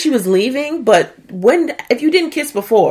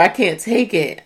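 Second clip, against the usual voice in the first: usual speaking voice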